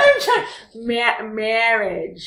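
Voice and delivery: slow goat-like bleat; bleating like a ram. Shown as a demonstration. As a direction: stretch it into a bleat. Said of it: "You sound like a goat who's become a human".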